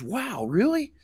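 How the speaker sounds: high, softish wonder as a donkey; gullible donkey. Acted. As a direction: fall for it, voice pitched high. Gullible donkey reacts in fable.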